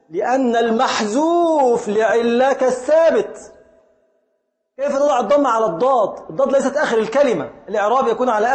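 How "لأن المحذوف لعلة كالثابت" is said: "المحذوف" stressed, whole rule said slowly and firmly; slow emphatic rule. Retold or imitated. Teacher voicing Ibn Hisham rejecting the damma, slow and emphatic. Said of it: "قال لك".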